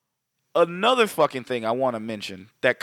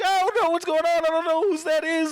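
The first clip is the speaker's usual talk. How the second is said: panicked high voice; 14 semitones higher than usual. Retold or imitated. Panicked guy denying money is his.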